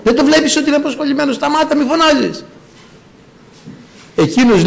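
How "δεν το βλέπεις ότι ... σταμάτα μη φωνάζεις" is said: high, fast scolding; scolding crowd. Retold or imitated. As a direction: tell him off hurriedly. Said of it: "του λέγανε". (βιαστική, ψηλή επίπληξη)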